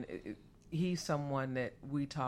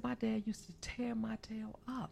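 whispered, higher, much softer; whispering; 5 semitones higher, 10 dB quieter than usual. Retold or imitated. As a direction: whisper it like a secret aside. Quoting the guest's dad, hushed.

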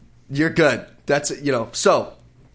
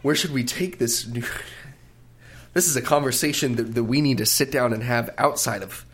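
ordinary talk, nothing marked

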